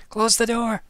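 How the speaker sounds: high, loud call from the back; sharp shout from the background; offstage librarian. Acted. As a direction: bark it from another room. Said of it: "a female voice just saying".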